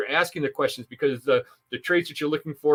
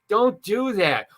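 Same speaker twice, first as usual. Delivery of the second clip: slow, high-pitched "Don't do that"; "Don't do that" drawn out and pitched up; emphatic warning; 7 semitones higher, much slower than usual. Real, not acted. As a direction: stretch each word of "Don't do that" as a warning. Emphatic warning after acted example.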